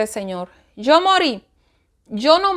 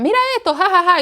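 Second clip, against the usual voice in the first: high mocking voice with a fake "ja, ja, ja"; mocking laughter; 8 semitones higher, 4 dB louder, faster than usual. Relatives mocking her body as she lay paralysed, laughing. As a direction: imitate them laughing at her, higher.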